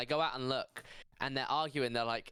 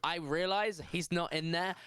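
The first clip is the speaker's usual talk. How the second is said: usual speaking voice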